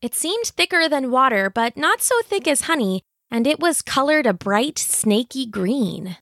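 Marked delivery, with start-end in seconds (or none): none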